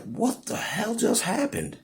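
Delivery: very high, soft, stunned imitation. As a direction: ask it high and dazed, hushed. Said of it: "you're like".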